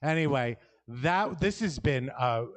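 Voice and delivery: ordinary delivery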